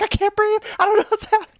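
high-pitched mock panic; acted freak-out. Woman re-enacting her Kmart panic attack, acted freak-out. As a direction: squeal it as if panic is rising. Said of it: "And I freak out".

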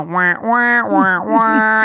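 nasal wah-wah babble like noise; mock it as meaningless chatter. Mocking the opinions as noise.

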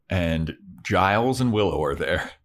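ordinary talk, nothing marked

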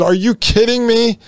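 high-pitched retold indignation; pissed-off disbelief. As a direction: reenact being ticked off, incredulous. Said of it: "I was pissed at this time".

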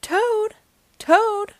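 high-pitched calling; crying out. A narrator's reading. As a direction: call the name out high and insistent. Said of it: "cried Frog".

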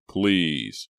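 loud, falling plea; pleading cry. A narrator's reading. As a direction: beg out loud for mercy. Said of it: "he cried".

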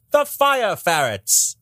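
high, slow mid-Atlantic announcer voice; transatlantic accent. Shown as a demonstration. Friends' ad-break joke, high slow transatlantic accent. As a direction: drag out "The fire ferrets" like an old-timey announcer in a transatlantic accent. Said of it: "The only thing I can do in that accent is".

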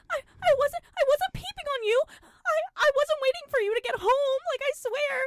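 very high, squealing mock protest; panicked denial. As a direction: stammer it out in a squeaky, loud imitation. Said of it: "Fred screams in pain".

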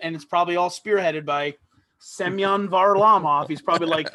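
ordinary talk

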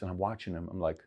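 normal talking voice

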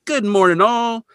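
high, chirpy mock-cheery greeting; cheerful greeting. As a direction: sing out a breezy hello, imitating a good mood. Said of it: "he's in a really good mood".

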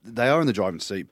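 "are" punched hard and loud; loud, firm weight on "are"; stress on ARE. Real, not acted. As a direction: insist on the word "are". Agrees, insisting on the word are.

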